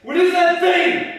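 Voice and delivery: high, loud, slow shouted questions; slow furious demand. Acted. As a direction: drag each question out in rage. Ray demands answers.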